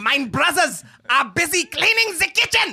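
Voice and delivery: mock German with an Austrian tinge; German accent. Player reading on request, in a German accent. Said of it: "with a German accent".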